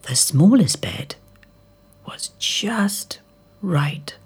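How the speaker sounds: whispering as she settles into bed; sleepy whisper. Acted. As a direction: drift off in a whisper. Sleepy Goldilocks finds bed.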